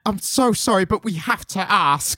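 high, hesitant, apologetic asking; timid interviewer. Retold or imitated. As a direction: nervously ask the grumpy writer. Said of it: "we both do an Alan Moore impression".

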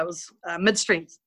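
normal talking voice

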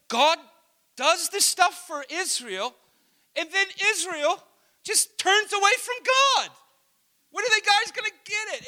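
put-on high, loud, exasperated kid; really frustrated. Five-year-old fed up with Israel, imitated loud frustration. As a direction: imitate a child's mounting indignation. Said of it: "he was really frustrated".

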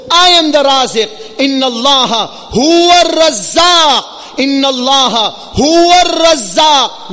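impassioned sermon delivery; forceful preaching. Real, not acted. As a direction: preach it urgently, driving each phrase home.

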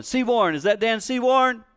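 calling out high across the airport; high, carrying mock call of a name; retold yell. Woman in the airport calls out to the preacher, high and carrying. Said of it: "I just hear someone yelling".

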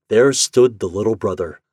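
plain reading voice

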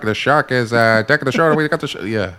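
fast, loud mock announcer; old radio announcer. Announcer breaks news of the shark, fast and loud. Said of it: "Like an old radio announcer".

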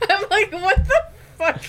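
high, laughing through the words; giggling in disbelief. Real, not acted. Laughing at fiber confusion.

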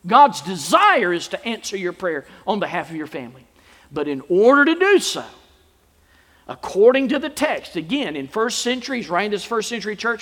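loud, high, forceful sermon delivery; emphatic preaching. Real, not acted. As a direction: preach it hard, punching key words.

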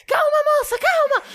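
high soothing plea, a bit hammed-up; calm her like she might jump; mock calming firefighter. Firefighters pleading with the girl to stay calm, high mock soothing.

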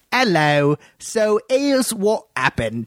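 high, slow put-on Cockney; Cockney accent. Podcast host starting his story for the co-host, mock Cockney accent. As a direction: open the story as a hammed-up Cockney. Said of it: "in a Cockney accent".

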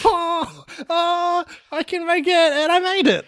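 high, shouting and laughing as he runs; a kid dashing gleefully across danger; excited kid. Acted. Boy runs across the minefield to hug papa, high excited shout.

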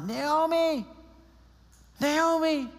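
high, excited calling of a name; townswomen calling out. Retold or imitated. Town women spotting Naomi return, excited high calls. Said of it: "All the women are like".

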